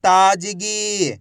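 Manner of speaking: whole word in one go, vowels held; syllables joined. Shown as a demonstration. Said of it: "तो मिलके बन जाएगा".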